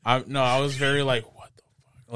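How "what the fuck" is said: barely audible whisper; whispered disbelief; under the breath. Retold or imitated. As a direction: mutter it to yourself, almost no voice. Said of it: "Like, under my breath".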